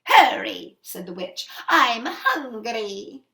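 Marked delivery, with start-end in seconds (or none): 0.0-0.8 loud impatient order; impatient witch. Acted. Witch pressing the mother to guess, loud and impatient. 1.7-3.2 loud, very slow drawn-out hunger; greedy witch. Acted. Witch telling the mother she is hungry, drawn out.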